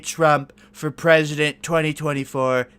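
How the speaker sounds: lightly voiced Trump bluster; a hint of Trump showmanship; Trump impression. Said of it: "This is Donald J. Trump".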